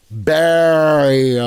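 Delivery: "Barry O." said very slowly, stretched out; corrected pronunciation. Shown as a demonstration. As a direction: drag out "Barry O." as the right way to say it. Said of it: "The L's are silent".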